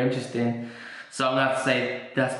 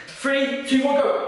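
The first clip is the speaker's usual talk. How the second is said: high, excited countdown to go; mock countdown; 10 semitones higher, slower than usual. Coach counting in the floss workout, excited and high. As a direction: count them in like a hyped coach.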